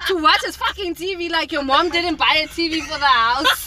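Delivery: loud, high-pitched acted telling-off; indignant mock rant. Retelling an inner rant at themselves for visiting, mock furious. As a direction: rail indignantly, hammering each accusation. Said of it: "I was punishing myself".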